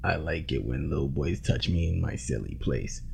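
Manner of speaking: oily leering creep; low, quiet, creepy leer; creep-ass voice. Acted. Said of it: "in a creep-ass voice".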